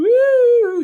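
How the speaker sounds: loud, high-pitched ghostly woo; spooky woo. Pretend. Guest adds haunted-hotel background noise, spooky woo. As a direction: hoot a mock-spooky sound effect. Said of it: "we have to have a woo".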